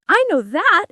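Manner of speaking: bursting to show she knows; eager cry. A narrator's reading. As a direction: blurt it out, keen to answer. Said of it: "Alice cried eagerly".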